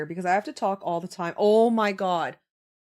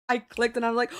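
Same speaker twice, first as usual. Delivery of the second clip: ordinary delivery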